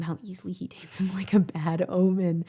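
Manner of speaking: unvoiced whisper, ominous; eerie whisper. Reader whispering the haunting line for effect. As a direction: breathe it like a bad omen.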